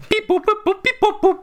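high beeping computer noises; toy robot voice. Pretend. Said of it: "Robot, Robot One".

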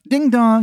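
high sung-out doorbell chime; mimicking a doorbell, high and bright; doorbell ding dong. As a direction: chime it like a doorbell, pitched well up. Pizza guy rings the doorbell.

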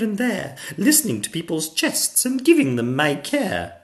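put-on high, loud, emphatic girl; princess crying out. A narrator's reading.

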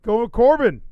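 high, loud booming call; loud announcement. Real, not acted. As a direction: announce it like a ring caller. Repeating the pick loudly.

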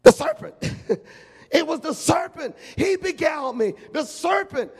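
pin it on the snake in a squeal; shrill blaming. Acted. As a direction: Eve shrieking out an excuse. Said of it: "she goes like this".

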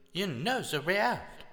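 high, eager Frenchman voice; excited pleading. Acted. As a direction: press him eagerly, rising. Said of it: "He began to speak quickly".

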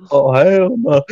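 loud, high retold protest; mock outcry. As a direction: cry out in comic refusal. Retold reaction to getting soaked.

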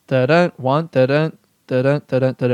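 each "da-dun" weak then strong; iambic beat. Shown as a demonstration. Podcaster demonstrating pentameter, "da-dun" stressed on the second syllable. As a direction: count out "da-dun" feet, leaning on "dun". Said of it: "an unstressed and then a stressed syllable".

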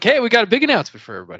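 fast, loud, overexcited host voice; mock hype announcement. Co-host mocks how they'd announce the trip, fast and loud. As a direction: rattle it off like a cheesy big reveal. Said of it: "we're gonna be like".

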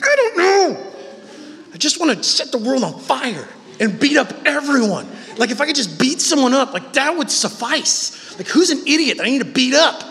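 hammed-up, high-pitched angry rant; comic anger. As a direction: rant like you're ready to fight everyone. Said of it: "I'm just angry".

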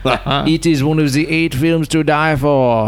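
hammed-up French filmmaker; French accent. Mock French filmmaker quote.

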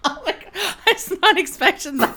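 high squeal through laughter; cracking up, loud and high-pitched; laughing disbelief. Real, not acted. Host reacting to guest's shock tagline, helpless laughter.

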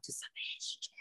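low breathy whisper; whispered alter ego. Pretend. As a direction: whisper it like a secret persona. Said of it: "Why your voice change when you say that?".

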